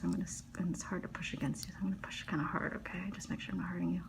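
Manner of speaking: hushed, breathy-free whisper while working. Real, not acted. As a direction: keep it to a close, voiceless whisper.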